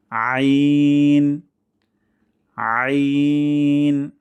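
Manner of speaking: loud, very drawn-out আইন from mid-throat; mid-throat ain. Shown as a demonstration. Teacher modelling for practice, long loud mid-throat আইন. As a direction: hold a thin আইন squeezed in the throat. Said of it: "গলার মাঝখান থেকে চেষ্টা করতে হবে".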